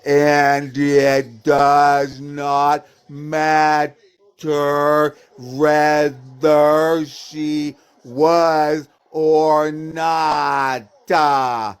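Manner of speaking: low, extremely slow drone; mocking slow. Real, not acted. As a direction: drag out every syllable as if for someone dim. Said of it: "I'll say it slowly".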